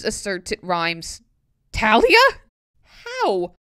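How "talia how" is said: puzzled. Real, not acted. Puzzled by a word.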